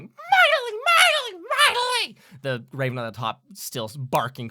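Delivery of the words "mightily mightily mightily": very high, loud squawked echoes; a flock chiming in, screeched; ringing bird cries. Acted. Crows echoing their fellow bird, loud high cries. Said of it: "hear ring out, rings of people going".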